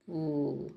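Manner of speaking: imitating a conch shell, long pooo; conch blow. Shown as a demonstration. Teacher shows the class a conch sound, a long blown note. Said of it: "तो पू होती है ना".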